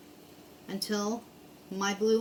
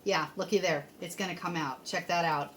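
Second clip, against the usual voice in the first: ordinary delivery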